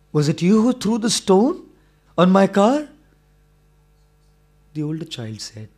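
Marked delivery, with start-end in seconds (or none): 0.1-2.9 angry demand. Acted. Angry man confronts boy.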